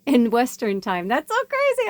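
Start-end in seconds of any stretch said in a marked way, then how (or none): 1.1-1.9 high, squealing that's so crazy; real excited squeal; amazed. Real, not acted. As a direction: gush with delight at the coincidence. Excited by synchronicity on clock.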